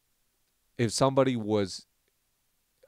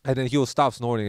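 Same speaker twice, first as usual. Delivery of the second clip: normal talking voice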